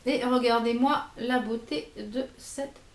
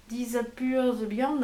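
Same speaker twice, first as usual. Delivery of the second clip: slow French-accented English; accent anglais approximatif; slower than usual. Real, not acted. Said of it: "toujours mon accent super anglais". (nom anglais étiré, avec l'accent français)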